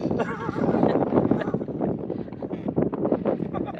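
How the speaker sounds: long, high breathy laughing fit. Real, not acted. Despite being told to hush, real helpless laughter. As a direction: laugh on, unable to stop. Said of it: "क्यों हंस रहे हो".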